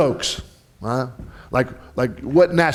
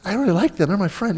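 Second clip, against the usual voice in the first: pleasant surprise; 7 semitones higher than usual. Acted. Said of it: "It's like the meal groups".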